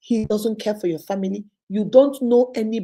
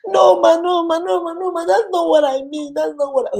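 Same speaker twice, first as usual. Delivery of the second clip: higher, louder insistent no; loud protesting client; 7 semitones higher, 5 dB louder than usual. Retold or imitated. Client loudly insisting to Mama Unique she means otherwise. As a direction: cut her off, higher and louder.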